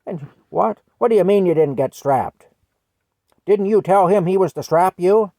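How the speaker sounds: put-on teacher shouting in disbelief at the boy; indignant outburst. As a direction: demand an answer in outraged disbelief. Teacher's outraged disbelief, retold.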